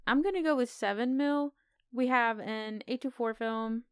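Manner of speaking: normal talking voice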